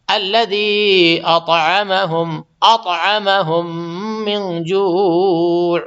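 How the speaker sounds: slow, drawn-out chanted tilawat; tajweed recitation; Quran recitation. Acted. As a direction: recite it melodically, stretching the long vowels. Recites Quran between explanations.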